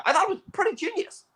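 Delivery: mock Tarantino. Imitating Tarantino praising the film, squeaky and fast. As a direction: rattle it off in a silly high voice. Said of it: "Yeah, he goes".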